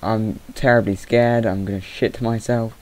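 usual speaking voice

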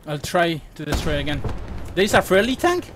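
usual speaking voice

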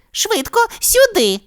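very high squeaky shrew voice; tiny squeak. A narrator's reading. Fru-Fru calls them to the exit, squeaky. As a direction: squeak it out small and quick. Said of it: "пискнула вона".

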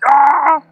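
loud sudden yell; metal shout. Retold or imitated. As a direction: belt out a heavy riff cry. Imitating a heavy metal hit.

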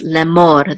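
le-MOR, lemor stressed on "mor"; stress on last syllable. Shown as a demonstration. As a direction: lean on the final "mor" of lemor. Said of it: "The accent is on mor".

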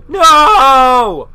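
long, high, loud "no"; drawn-out protest, high and loud; dismayed shout. Real, not acted. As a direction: cry out in real dismay. Dismayed reaction to the map vote.

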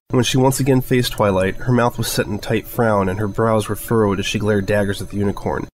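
ordinary delivery

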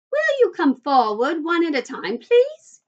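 high, fluty voice calling out; fluty, lilting host rising on the last word; Willy Wonka. A narrator's reading. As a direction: call to a crowd, high and loud. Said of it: "His voice was high and fluty".

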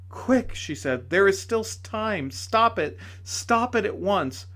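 high, urgent pressing; urgent plea. A narrator's reading. Lady urging him to let go, high and urgent. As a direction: hurry him, pressing hard.

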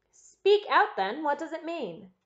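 high, loud opening command; sharp demand. A narrator's reading. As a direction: demand an explanation, voice jumping up. Baronet demands an explanation.

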